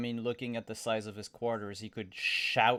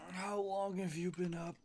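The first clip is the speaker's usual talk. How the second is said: in-character question; 9 semitones higher than usual. Acted. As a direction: ask it as your character, pitched up. Player speaks as character.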